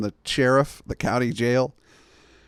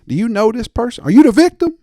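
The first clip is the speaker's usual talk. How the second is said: much higher, loud questioning; shrill clerk voice; 10 semitones higher, 10 dB louder, faster than usual. Retold or imitated. Clerk grilling the victim on the phone, high and loud. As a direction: fire the questions at her in a high squeal.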